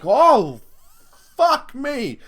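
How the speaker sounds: high, loud startled cry with a laugh; startled yelp. Retold or imitated. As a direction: jump back in shock and laugh it off. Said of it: "I'm like".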